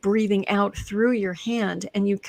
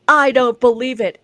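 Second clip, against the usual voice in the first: loud, flat denial, with no doubt in it; loud disbelief; 3 semitones higher, 9 dB louder than usual. Retold or imitated. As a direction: insist loudly it cannot be. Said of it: "And then she goes".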